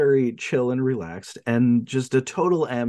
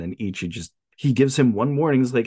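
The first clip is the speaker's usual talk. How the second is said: ordinary delivery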